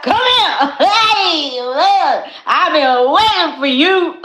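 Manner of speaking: loud, high, laughing call of her future man; imagined man calling her. Retold or imitated. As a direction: boom out his welcome through laughter. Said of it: "He gonna be like".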